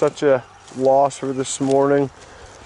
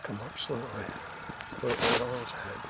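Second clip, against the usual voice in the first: hushed hunter's whisper; wildlife watching whisper; 3 semitones lower, 21 dB quieter than usual. Real, not acted. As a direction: keep it low so the bird won't hear. Whispering while hunting a bird.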